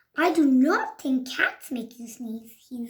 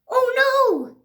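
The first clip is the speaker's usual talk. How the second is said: high, loud alarmed cry; 11 semitones higher, 6 dB louder than usual. Acted. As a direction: gasp out in alarm. Alarmed reaction.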